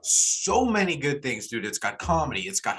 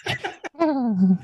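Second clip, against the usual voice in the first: high, goofy put-on voice; high silly voice. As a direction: talk it in a high cartoon voice.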